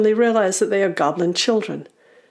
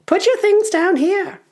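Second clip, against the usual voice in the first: high, loud goblin voice; goblin order; 14 semitones higher, 5 dB louder than usual. Acted. Goblin telling the others to stop, high goblin order. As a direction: order the band in a high creature voice. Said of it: "says one of them finally".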